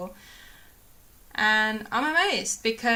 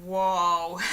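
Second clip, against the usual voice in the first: amazed whoa; 3 semitones lower, 5 dB louder than usual. Retold or imitated. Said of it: "I was just like".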